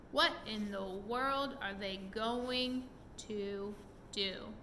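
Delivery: ordinary delivery, nothing marked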